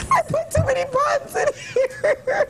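high-pitched laughing through the news; helplessly giggling, can't read on; anchor stifling giggles. Real, not acted. Anchor still laughing, fast and high.

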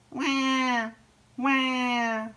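high, drawn-out imitated wail; baby seal cry. A narrator's reading. Lost baby seal crying from the beach, high wail. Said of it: "she heard a cry from the beach".

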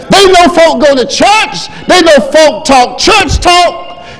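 high, loud, slow preaching; preaching at full force. Acted. Preacher crying out to the church about saving power, loud and high. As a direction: whoop it to the church.

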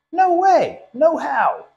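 high, loud panicked yelp; panicked cry. Acted. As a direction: shriek in alarm. Turkey panics at the news.

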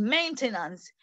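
ordinary delivery

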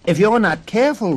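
put-on girl calling out. A narrator's reading. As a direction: call a quick warning across the pond. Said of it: "Rose Red called".

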